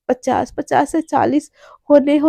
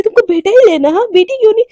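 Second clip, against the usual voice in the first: indignant challenge; 6 semitones higher, 6 dB louder, faster than usual. Acted. Challenging partner in acted bickering.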